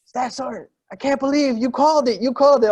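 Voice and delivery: high, loud, amazed quote; amazed caller. Retold or imitated. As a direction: marvel at how he called it. Caller amazed at his prediction.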